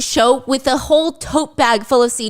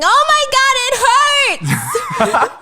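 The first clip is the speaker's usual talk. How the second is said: mock screaming; 13 semitones higher, much slower than usual. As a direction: scream in mock agony, breaking into laughter. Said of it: "He starts screaming".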